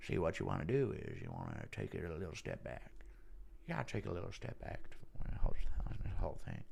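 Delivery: low, soft Texan actor impression; Matthew McConaughey. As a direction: soothe softly like a Texan philosopher. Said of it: "Impression of Ngannou and McConaughey".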